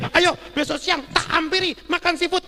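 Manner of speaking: loud, high, imitating an excited friend; hearty acted invite to an old pal; eager invitation. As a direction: boisterously insist on treating him.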